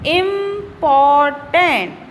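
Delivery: slow, stretched-out important in separate syllables. Shown as a demonstration. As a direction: draw out each syllable of important. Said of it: "syllable होती है मात्राएं".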